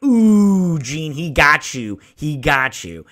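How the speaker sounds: excited mock gloating at Jean; teasing ooh. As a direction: gloat like a fan watching a burn land. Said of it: "And I'm like".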